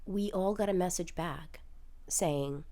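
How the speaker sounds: normal talking voice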